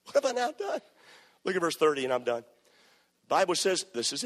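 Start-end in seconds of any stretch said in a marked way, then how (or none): 0.1-0.8 high, wounded protest; indignant David. Acted. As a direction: act innocent and offended.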